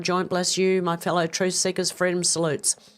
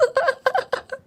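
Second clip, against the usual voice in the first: high, helpless giggle; giggling fit. Real, not acted. Streamer laughing at her own confusion, high giggle.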